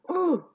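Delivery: high, loud "ooh" of effort; mimicked groan. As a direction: imitate a man straining to bend over. Mimics neighbour bending over.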